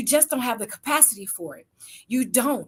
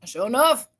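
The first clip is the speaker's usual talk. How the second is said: ordinary delivery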